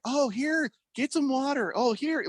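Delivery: put-on high-pitched fussing old lady; imitated old lady. Sandwich shop lady offering water to her driver, imitated old lady. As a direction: pitch it way up as a concerned old woman. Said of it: "the old lady, she's like".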